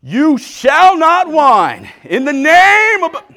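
hammed-up exorcism, loud and high; drawn-out, booming mock-preacher command; very spiritual preacher. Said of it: "I get very spiritual".

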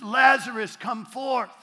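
loud, high command to the tomb; commanding call. Acted. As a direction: call him out of the grave with full force. Jesus calling dead Lazarus out.

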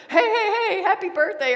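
high, loud hey hey hey; husband bursting in with a loud hello; loud greeting. Retold or imitated. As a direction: call out cheerfully to announce yourself. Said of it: "his voice went".

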